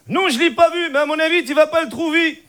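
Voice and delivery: put-on high Moroccan-accented French; Hicham. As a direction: answer in a thick, comic Moroccan accent. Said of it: "l'accent arabe".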